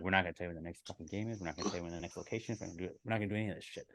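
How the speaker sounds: rattled off under the breath; low fast aside. Retold or imitated. Friends vow to hide the next game, low and quick. Retold vow after leaving the fan.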